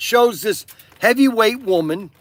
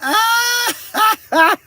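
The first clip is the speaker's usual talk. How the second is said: high, loud burst of real laughter; high cracking belly laugh; helpless laugh; 13 semitones higher, 3 dB louder than usual. Real, not acted. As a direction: lose it completely at the joke. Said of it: "Oh, I almost lost it".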